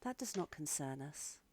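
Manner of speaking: soft, eerie otherworldly woman; quiet, aloof many-voiced creature; lightly voiced unnerving Calantha. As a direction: dismiss them softly as an unnerving being. Game master voices Calantha.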